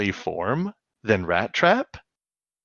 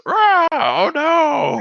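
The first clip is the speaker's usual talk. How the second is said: high squealing panic, much higher; panicked cartoon villain; 6 dB louder, much slower than usual. Recapping the episode, a villain's high panicked squeal. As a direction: yelp it out in a high mock panic.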